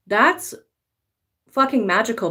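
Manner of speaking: normal talking voice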